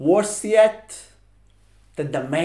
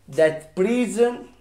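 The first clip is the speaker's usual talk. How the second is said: ordinary talk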